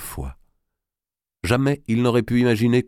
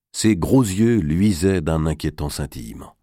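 ordinary delivery

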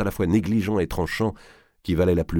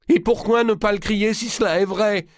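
very high, loud shouting; losing control; 4 dB louder than usual. A narrator's reading. Louis defies the king, shouting out of control. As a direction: let the anger run away with you. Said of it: "perdant tout contrôle".

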